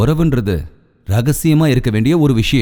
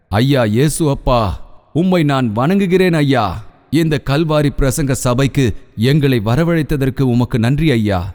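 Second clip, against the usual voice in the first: fervent prayer; 4 semitones higher than usual. Acted. Pastor praying aloud for the congregation, fervent building prayer. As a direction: plead for deliverance, building.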